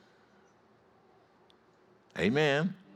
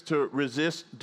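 ordinary delivery

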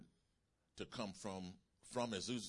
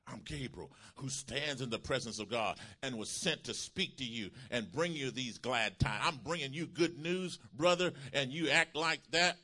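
raised voice building to loud indignation; forceful; 4 semitones higher, 8 dB louder than usual. Acted. Gabriel shouts back at Zacharias, forceful and building. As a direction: start firm, build in force and pitch. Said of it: "Gabriel shouted back with force and power".

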